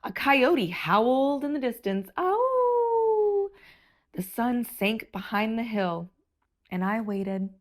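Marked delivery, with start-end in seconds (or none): 2.2-3.5 surprised oh. Acted. Birthday, nobody arrives.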